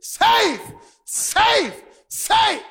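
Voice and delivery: high excited shouts of safe; cheering safe. Retold or imitated. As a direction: shout it like the home team celebrating. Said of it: "came out of the dugout cheering".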